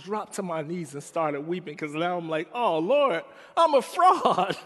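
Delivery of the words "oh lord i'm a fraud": high, loud, slow wail laughing through it; anguished cry. Retold or imitated. Weeping on his knees before God, loud anguished cry. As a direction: cry out half laughing at himself.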